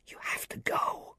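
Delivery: urgent breathless whisper. A narrator's reading. Anna whispering to William to warn him, an urgent whisper. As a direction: whisper so the next room can't hear. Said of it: "she whispered".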